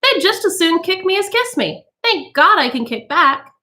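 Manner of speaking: high, chirpy and amused; tittering society woman. A narrator's reading. Mrs Bowles joking to the women about her children, tittering. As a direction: titter through it carelessly.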